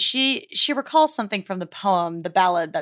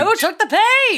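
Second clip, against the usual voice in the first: mock dramatic; 13 semitones higher, 5 dB louder than usual. Mock dramatic echo of question.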